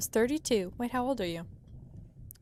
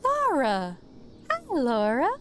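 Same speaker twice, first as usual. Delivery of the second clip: bright waitress greeting; 4 semitones higher, slower than usual. Acted. Friendly waitress calling out.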